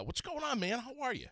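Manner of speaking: high, bright welcome to a caller; excited greeting. Real, not acted. Radio host welcoming caller Noah, pitched high and eager.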